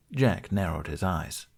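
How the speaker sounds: usual speaking voice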